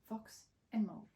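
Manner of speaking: usual speaking voice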